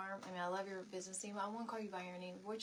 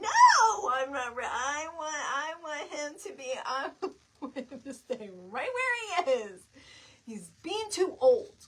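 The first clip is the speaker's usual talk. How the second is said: mock wail; 4 semitones higher, 11 dB louder than usual. Real, not acted. Mom not ready, distressed.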